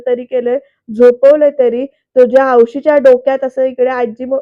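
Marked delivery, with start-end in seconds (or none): 2.2-3.4 loud scolding yell; imitated yelling grandma. Grandma yells at Surya about his mother, loud scolding. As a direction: shout it like an angry old woman. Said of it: "ओरडत असते".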